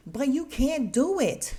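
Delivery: loud high yell; mock scream. Nanny cutting off the mom, loud and high. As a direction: belt it out like a blunt nanny. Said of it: "And Gigi screams".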